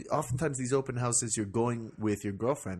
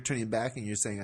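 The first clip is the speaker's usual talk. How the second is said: normal talking voice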